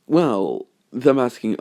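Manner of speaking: usual speaking voice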